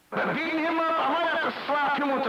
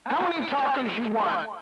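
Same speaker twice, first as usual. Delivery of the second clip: ordinary talk, nothing marked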